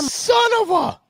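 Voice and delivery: sudden high shout of disbelief; outraged shout. Real, not acted. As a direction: yell it out, caught off guard. Outraged at news footage.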